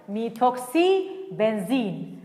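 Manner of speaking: stretched-out soft naming of the answer; slow final answer. Shown as a demonstration. Teacher slowly revealing the correct compound name to students. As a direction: draw the name out gently so it sticks. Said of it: "final answer drawn out".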